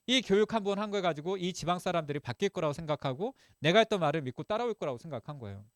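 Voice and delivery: normal talking voice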